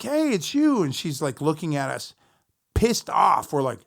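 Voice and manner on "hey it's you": high, bright mock hello; cheery greeting. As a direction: greet her like an old friend. Greeting the roadie's girlfriend.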